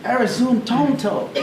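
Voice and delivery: high, slow mock shout of an angry pastor; imitating a yelling pastor, high and slow. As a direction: ham up the pastor yelling an insult. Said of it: "mi pastor grita".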